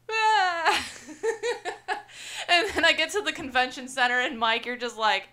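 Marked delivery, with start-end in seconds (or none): 0.1-2.4 high exasperated cry into a laugh; laughing wail. Retold or imitated. Wife telling the cabbie her day with a high laughing wail. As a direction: let it crack high into laughter. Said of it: "And I was like".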